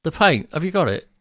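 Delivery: high, loud startled questions; panicked surprise. Retold or imitated. Homeowner caught out by builder's question, loud startled panic. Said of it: "And you go".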